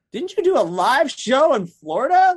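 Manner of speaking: high, loud mock disbelief; hammed-up incredulous challenge, stretched at the end. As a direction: call out his tall story, voice shooting up. Acted disbelieving reply to boaster.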